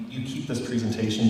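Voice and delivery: normal talking voice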